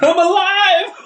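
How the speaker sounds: high, loud laughing exclamation; mock triumph. Retelling waking up alive, high laughing triumphant cry.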